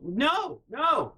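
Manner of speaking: normal talking voice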